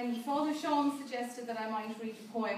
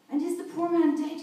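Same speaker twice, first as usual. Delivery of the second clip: priest cries out, higher and louder; anguished cry; 6 semitones higher, 9 dB louder than usual. Acted. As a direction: call out the question in alarm. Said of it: "he cried".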